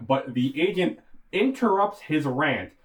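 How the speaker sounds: usual speaking voice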